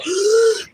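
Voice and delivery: sudden high gasp for air; sharp gasp of breath. Shown as a demonstration. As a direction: gasp as the face hits water. Demoing face in the water.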